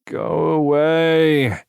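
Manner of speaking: sleepy, slow mumbled "go away"; half-asleep grumble; groggy mumble. A narrator's reading.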